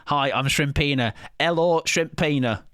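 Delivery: drawn-out Northern heartbreak; Northern accent. Retold or imitated. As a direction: Shrimp begging back in a Northern accent. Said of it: "I don't know what that accent is".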